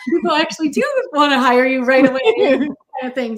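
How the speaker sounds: laughing through the words; giggling as she talks. Real, not acted. Laughing while talking.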